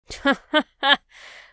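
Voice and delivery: loud high cackle; the old rat laughs from the sidelines, loud and high; laughing rat. Acted. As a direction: laugh with glee at the fight. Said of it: "Botticelli laughed from the sidelines".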